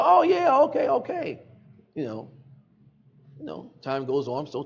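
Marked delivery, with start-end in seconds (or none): 0.0-1.3 much higher, louder easygoing reply; breezy agreement. Retold or imitated. Told he can't visit, he agrees high and breezy. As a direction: agree without a second thought. Naive agreeing to family, retold.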